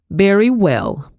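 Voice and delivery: slow, loud, pointed reply aimed past her husband; said loudly. A narrator's reading. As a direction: drag it out loud so the guest hears. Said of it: "she said loudly".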